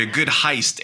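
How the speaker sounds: ordinary talk, nothing marked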